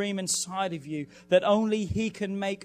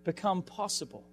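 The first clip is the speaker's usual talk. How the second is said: preaching at full force, higher and louder; 4 semitones higher, 4 dB louder than usual. Acted. Pastor urging the congregation before the fast, full-force preaching. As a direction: drive it home like a revival preacher, rising.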